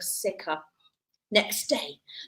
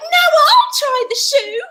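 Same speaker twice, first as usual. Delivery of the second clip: yelling sister; 10 semitones higher, 5 dB louder than usual. Acted. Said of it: "who yelled".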